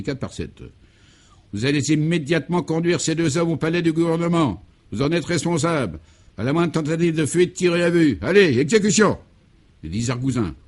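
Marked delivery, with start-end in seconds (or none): 1.5-9.2 barking commander. Acted. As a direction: bark the arrest order to the agents. Officer orders agents to arrest.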